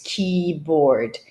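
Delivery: keyboard stretched, bored at the end; slow keyboard, lean on bored; slow model keyboard. Shown as a demonstration. Teacher models keyboard slowly for students. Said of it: "Think about the bored image".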